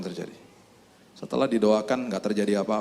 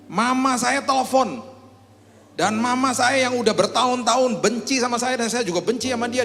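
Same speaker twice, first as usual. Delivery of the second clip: defiant challenge; 11 semitones higher, 3 dB louder than usual. Acted. Atheist friend daring the believer to pray. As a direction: throw down the challenge hard.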